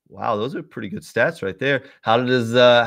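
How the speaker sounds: ordinary talk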